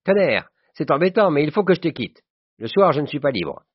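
high, loud, vexed outburst; exclaiming cry. A narrator's reading. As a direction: exclaim that you must rush off. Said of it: "Et il cria". (s'écrie, contrarié, voix haute)

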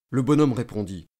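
plain reading voice

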